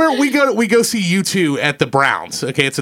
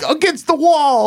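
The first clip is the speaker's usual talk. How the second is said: much higher prissy command; like a sissy; 11 semitones higher, slower than usual. Retold or imitated. Tough-talk lesson acted out, high sissy version. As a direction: bark it out limply like a sissy. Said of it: "like a sissy".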